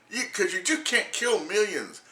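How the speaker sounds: normal talking voice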